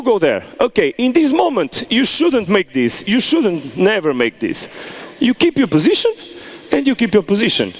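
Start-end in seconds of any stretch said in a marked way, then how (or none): none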